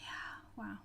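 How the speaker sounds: soft whispered wow. Real, not acted. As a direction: breathe it out quietly. Whispered reaction after sigh.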